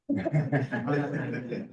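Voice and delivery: short laugh. Real, not acted. Laughs when told to move on.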